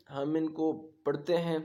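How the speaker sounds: ordinary talk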